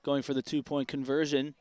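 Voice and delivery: energetic sportscaster calling the play. Acted. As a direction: call it like live football radio. Live play-by-play call.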